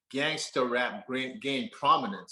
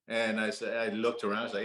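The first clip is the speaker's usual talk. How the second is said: ordinary talk, nothing marked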